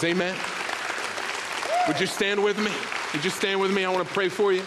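ringing altar call; preaching at full force. Real, not acted. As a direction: rouse the church to its feet. Calls congregation to praise.

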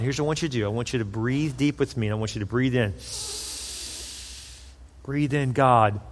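soft and low, guiding her breath; calm breathing guide. Retold or imitated.

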